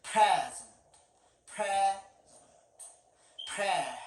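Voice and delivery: "Present" with PRE strong, ending dropped away; stress on first syllable. Shown as a demonstration. Teacher drilling the noun for students, first-syllable stress on "Present". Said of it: "First syllable stress".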